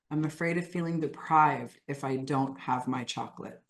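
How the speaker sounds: subdued, low confession in short phrases; guided self-talk. Acted. Coach voices the group's attachment to chocolate, subdued. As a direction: confess it softly, leaving room to repeat. Leads repeat-after-me script.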